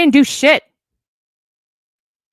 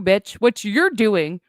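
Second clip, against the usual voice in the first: usual speaking voice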